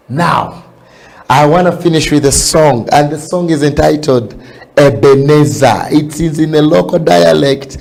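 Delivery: loud, high, fervent preaching; preaching at full force. Real, not acted. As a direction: lift the congregation with a booming call. Loud closing exhortation to dance.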